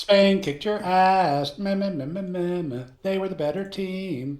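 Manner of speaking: high mocking nyah voice; taunting. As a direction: taunt like a sore winner.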